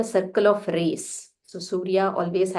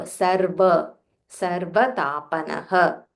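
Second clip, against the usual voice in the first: "Sarva" with the half r and the v clearly pressed; stress on sarva. Shown as a demonstration. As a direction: lean on the r and v of "Sarva", slowly. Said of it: "Stress on this half ra and this va".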